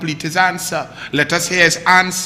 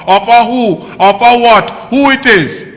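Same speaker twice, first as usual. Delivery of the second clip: indignant questions; 2 semitones higher, 6 dB louder than usual. Real, not acted. Mocking ranting questions.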